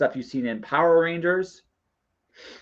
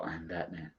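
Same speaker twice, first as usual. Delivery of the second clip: low, hushed superhero growl; Lego Batman; 7 semitones lower, 13 dB quieter than usual. Retold or imitated. Fan quotes Lego movie hero's answer, low mock-brooding. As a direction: drop it low and quiet, mock-heroic. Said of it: "my favorite Batman of all time is Lego Batman".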